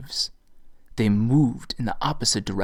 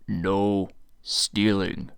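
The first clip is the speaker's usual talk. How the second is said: big man, few words, slow; slow deep warrior voice; 4 semitones lower, 3 dB quieter, much slower than usual. A narrator's reading. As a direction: say it slowly and heavily. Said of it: "the half-naked man said".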